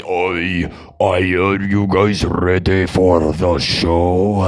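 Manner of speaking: very low, slow German frontman voice. Retold or imitated. Imitating the Rammstein singer greeting a concert crowd, low and slow. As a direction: address the crowd deep and slow like a metal singer. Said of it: "He talks like this the whole time".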